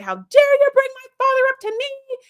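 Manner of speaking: high, loud shriek of indignation; hammed-up imitation of someone hitting the roof; mock outrage. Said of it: "made her hit the roof".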